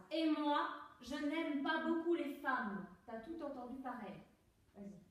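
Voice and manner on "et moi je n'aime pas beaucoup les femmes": loud and slow, every word carried to the end; loud, distinct projection. Shown as a demonstration. Coach showing actress how to reach the phrase's end, loud and distinct. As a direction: project to the back of the room, finishing the phrase. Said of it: "tu ailles jusqu'au bout de la phrase".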